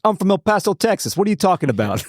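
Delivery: high-pitched mock Brooklyn accent; bad Brooklyn accent. Retelling a comedian's bit to co-host, attempted Brooklyn accent. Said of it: "I can't do a Brooklyn accent".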